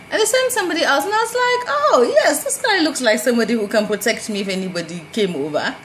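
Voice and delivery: high, loud, laughing delight at the new guard; gushing with relief, pitch way up. Retold or imitated. Telling the company about the new guard, delighted and laughing.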